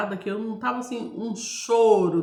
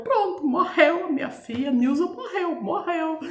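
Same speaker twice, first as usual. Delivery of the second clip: panicked father; 8 semitones higher than usual. Retold or imitated. Father outside the door fearing his daughter died, panicked. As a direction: blurt it out, sure she's dead. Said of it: "ele ficou apavorado".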